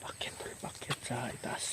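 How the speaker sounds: very soft whisper to a companion; real hushed whisper on the move; stealthy whisper. Real, not acted. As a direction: whisper the way up, barely audible.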